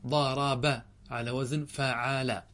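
slow, separated syllables on "ضارب" and "فعال"; drawn-out syllables. Shown as a demonstration. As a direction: stretch the word and its pattern syllable by syllable. Teacher matches word to its pattern. (نطق بطيء مقطّع للتعليم)

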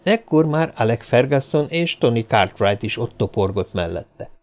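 ordinary delivery, nothing marked

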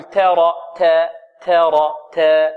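ترى with the "ta" fronted towards "teh"; taqlil, vowel tilted. Shown as a demonstration. Teacher shows the forbidden taqlil, "teh" for تا. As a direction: tilt the a of تا towards e, held slowly. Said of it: "هذا تقليل".

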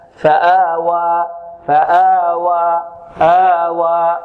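slow chanted فآوى, both long vowels matched; two equal alifs. Shown as a demonstration. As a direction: draw out each "aa" in فآوى to the same count. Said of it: "نقيسها بالألف التي بعد الهمزة هنا". (تلاوة بطيئة بمدين متساويين في فآوى)

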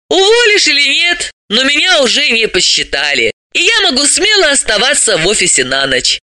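high-pitched, brisk explainer; high character voice, instructive; animated female character, businesslike. Acted. Voices Dalila explaining the system.

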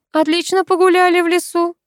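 mock sympathy. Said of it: "участливо подсказал некромант".

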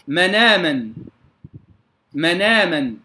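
مناما run together, hamza dropped, short vowels; Warsh naql. Shown as a demonstration. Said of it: "يقرؤون بالنقل". (نقل ورش في مناما بالقصر)

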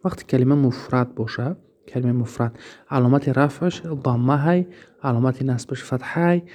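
usual speaking voice

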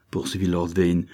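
usual speaking voice

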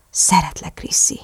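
soft confession sinking to a whisper; tender half-whisper. A narrator's reading. Lover confessing love to Chrissy, tender half-whisper. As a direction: say it gently, trailing into breath.

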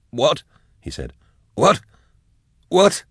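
high, frantic repeated questions; disoriented and scared, voice rising; panicked. Acted. Drusha frantic after the word.

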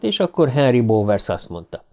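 usual speaking voice

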